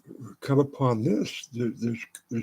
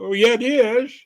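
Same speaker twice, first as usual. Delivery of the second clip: higher, louder insistence; defiant retort; 10 semitones higher, 6 dB louder than usual. Retold or imitated. Answering doubters with a loud, defiant yes. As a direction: fire back that it is. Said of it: "people go / reply".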